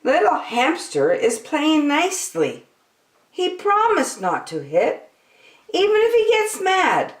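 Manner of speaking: high bunny voice, rising at the end; Bunny's voice. A narrator's reading. Bunny defends the hamster to the others, high. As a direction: stand up for him like a little bunny. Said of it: "Bunny says".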